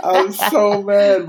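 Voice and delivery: high slow laughing. Real, not acted. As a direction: laugh through the words. Retelling anger.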